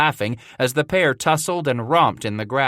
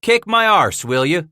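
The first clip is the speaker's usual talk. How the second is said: higher, louder gleeful challenge; gleeful cry; 7 semitones higher, 5 dB louder than usual. A narrator's reading. Scratch crying out in glee at Hatcher's threat. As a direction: relish the fight coming. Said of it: "Scratch cried in glee".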